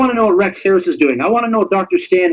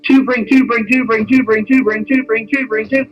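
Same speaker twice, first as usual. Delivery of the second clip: higher, singsong chain of repeats; rhythmic rattling repetition; 4 semitones higher than usual. Real, not acted.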